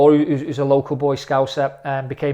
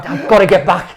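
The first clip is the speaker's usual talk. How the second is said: loud, raised-pitch urgency; urgent insistence; 5 semitones higher, 11 dB louder than usual. Retold or imitated. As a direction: blurt it out as if time is running out. Retelling urgency to cut holiday short.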